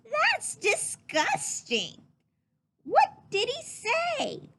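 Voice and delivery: put-on high, slow, loud disgusted child voice. A narrator's reading. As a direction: drag out the disgust like a grossed-out kid.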